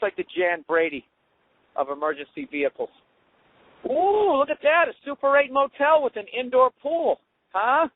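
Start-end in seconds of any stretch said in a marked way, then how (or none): 3.9-7.9 much higher, sarcastic gasp of wonder; mock amazement. Real, not acted. Driver sneering at a Super 8 motel, mock-impressed and much higher. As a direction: gush like a Super 8 pool is a palace.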